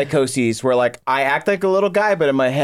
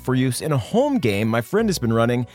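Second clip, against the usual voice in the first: upbeat sponsor read. Acted. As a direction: sell it like a podcast ad.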